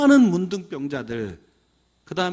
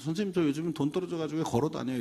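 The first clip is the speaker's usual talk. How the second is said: quick, softer throwaway complaint; offhand passing remark; 3 semitones lower, 7 dB quieter, faster than usual. Acted. Student casually grumbling to teacher about running out of money, offhand. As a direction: toss it off quickly and quietly in passing. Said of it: "이렇게 그냥 지나가는 말로 한 거예요".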